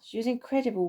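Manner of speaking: usual speaking voice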